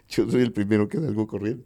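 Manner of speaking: chuckling through the words; laughing. Real, not acted. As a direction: say it while laughing at your own joke. Laughing at his own joke. (entre risas)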